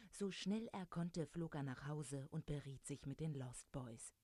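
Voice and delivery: plain reading voice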